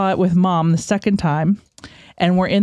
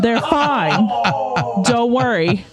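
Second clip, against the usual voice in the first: higher mock New Jersey voice; New Jersey accent; 5 semitones higher, much slower than usual. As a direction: imitate a Jersey woman, pitched up. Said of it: "I always give them a New Jersey accent".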